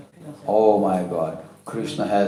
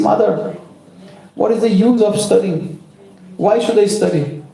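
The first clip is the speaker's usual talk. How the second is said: put-on high-pitched child's protest; questioning child; 8 semitones higher than usual. A narrator's reading. Son asks mother why he should study, protesting.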